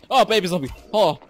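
loud, high burst of alarm; real gamer jump-scare shout; startled cry. Real, not acted. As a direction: yelp at a sudden threat. Startled by monsters in game.

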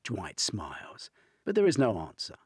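ordinary delivery, nothing marked

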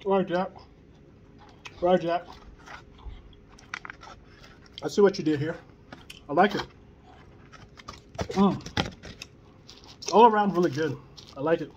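chewing between words; talking through a bite; mouth partly full. Real, not acted. Seen 1: Holds sandwich throughout, bites at 4.7, lips working between phrases.

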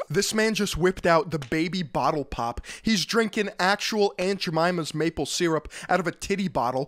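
ordinary talk

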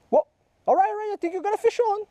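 high-pitched rush of excitement, fast; excited. Real, not acted. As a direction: blurt it out as the line tugs. Thinks nephew hooked a fish.

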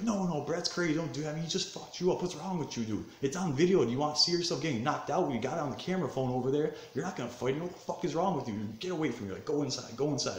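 high-pitched, exasperated remonstrating; acted urgent pleading. Boys stopping him from fighting again, exasperated.

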